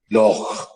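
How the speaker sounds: loch with a rasping Scottish ch; Scottish guttural sound. Shown as a demonstration. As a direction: end loch with a throaty ch. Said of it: "that guttural sound that you just heard".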